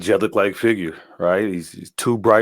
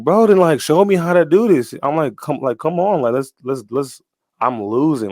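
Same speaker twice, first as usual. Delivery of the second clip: exasperated plea; 7 semitones higher, 5 dB louder than usual. Retold or imitated. Retold plea to dream figure while losing.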